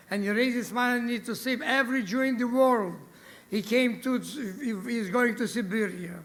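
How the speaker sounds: ordinary delivery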